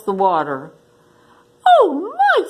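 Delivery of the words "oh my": high little mouse voice; squeaky mouse. Acted. Mouse thanks the lion, high and squeaky. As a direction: play the tiny grateful mouse, high.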